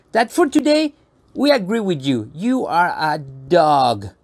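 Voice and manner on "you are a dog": very slow, drawn-out "dog"; slow reassurance. Pretend. Presenter humouring a crying puppet, slow and deliberate. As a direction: spell it out slowly to soothe.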